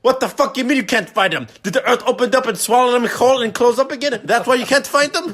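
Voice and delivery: furious shout. Retold or imitated. Dad screaming sarcastically at the clerk on the phone, enraged yell. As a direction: roar the sarcasm down the line.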